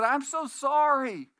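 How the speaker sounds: a sick wife fussing, pitched well up; apologetic sick wife. Retold or imitated. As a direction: whine the apology from the sickbed. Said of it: "And she said".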